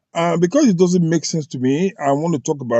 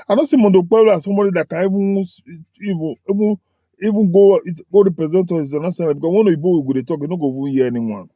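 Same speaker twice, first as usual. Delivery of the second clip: words rush out faster and faster; fast heated rant; 5 semitones higher, faster than usual. Real, not acted. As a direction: rattle it off in frustration.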